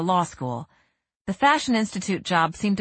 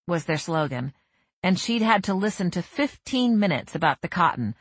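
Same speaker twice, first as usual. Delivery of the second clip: plain reading voice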